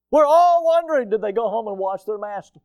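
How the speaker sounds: high, loud mock eagerness; sarcastic mock interest. Preacher mocking reporters' softball questions, sarcastic. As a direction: overplay the breathless interest, loudly.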